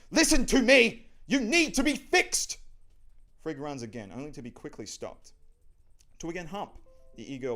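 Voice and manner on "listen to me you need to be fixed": high, very loud demand; full-force angry shriek; shouting after someone. Acted. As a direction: yell it down the hallway. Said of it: "shouts after him".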